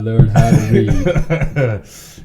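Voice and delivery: slow, loud joke through laughter; laughing mock intro. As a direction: blurt it out laughing.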